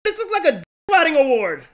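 loud, stunned disbelief; surprised shouting at an unexpected award. Real, not acted. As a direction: react like you can't believe it.

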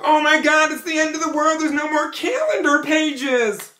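high, hammed-up panic, slowing at the end; mock freak-out. Mocking people freaking out, a high mock panic. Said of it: "everybody freaking out saying".

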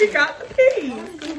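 slow and loud, breaking into laughter; laughing. Real, not acted. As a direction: laugh while saying it. Laughing over the bad peas.

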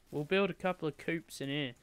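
ordinary delivery

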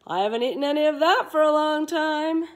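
dinosaur voice. Acted. Dinosaur voice in story.

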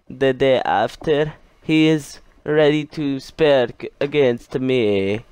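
slow, put-on accent like Lana's. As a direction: spell it out slowly in a Lana accent. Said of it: "I don't know why I'm taking this Lana accent".

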